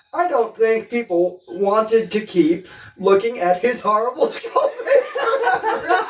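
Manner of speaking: reading through helpless laughter, pitch soaring; cracking up. Real, not acted. Host reading a quote about Red Skull's face, cracking up. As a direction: read slowly until laughter swallows the words.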